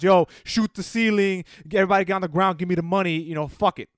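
mock robber's loud command; lightly hammed-up stickup shout; dumb villain. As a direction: order everyone around like a cartoon crook. Mocking generic dumb villain robbers.

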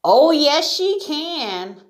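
high, loud cry of the animals; spirited acted group protest; chorused retort. A narrator's reading. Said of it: "chorused the other animals".

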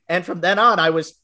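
usual speaking voice

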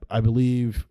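ordinary delivery

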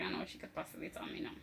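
fast, hushed worried thought; whisper. Retold or imitated. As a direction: whisper it quickly to yourself.

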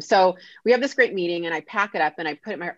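ordinary delivery

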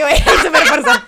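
shouting through laughter; loud laughing. Real, not acted. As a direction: blurt it out laughing hard. Laughing outburst mid-joke. (gritando entre risas)

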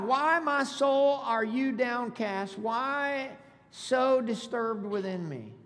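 slow, emphatic preacher's reading; scripture read aloud. Acted. Pastor reading verse five to congregation, slow emphatic scripture reading. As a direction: draw out each question of the verse.

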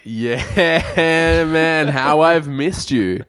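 high, loud and drawn-out; fond, excited greeting. Retold or imitated. Viewer greets the returning comedy star, gushing and loud. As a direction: hail a long-missed favourite, stretching the words. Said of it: "I was like".

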